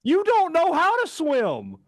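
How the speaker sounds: very high, slow, drawn-out defiance; bold retort. Retold or imitated. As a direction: retell a kid boldly challenging mom, stretching each word. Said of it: "I've never been more bold".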